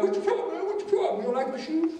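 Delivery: high, loud-edged mock aggression; confrontational challenge. Shown as a demonstration. Showing students how not to approach a bully, confrontational. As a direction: throw it out like picking a fight. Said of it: "but don't be like".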